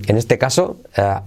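normal talking voice

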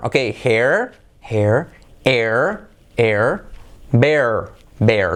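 "hair", "air", "bear" each said slowly with an English r, pausing between; repeat after me. Shown as a demonstration. Teacher drilling students, clear slow model words. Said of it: "repite conmigo".

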